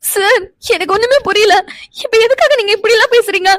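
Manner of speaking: upset, tearful pleading. Acted. Woman pleading with angry sir to explain, tearful. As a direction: plead through tears, bewildered. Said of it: "நீயா அழுதுட்டு இருக்காத".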